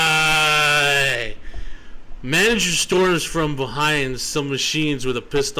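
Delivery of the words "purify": dramatic proclamation. Acted. Green text, dramatic proclamation.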